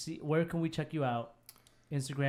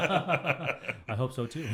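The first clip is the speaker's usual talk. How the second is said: laughing; 3 semitones higher, 7 dB louder, much slower than usual. Real, not acted. Slow laughing reply.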